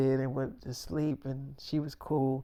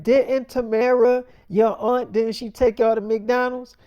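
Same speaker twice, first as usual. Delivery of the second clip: a scolding question; stern mom; 8 semitones higher, 8 dB louder than usual. Retold or imitated. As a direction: mom questioning her kids, louder and stern. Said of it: "She's like".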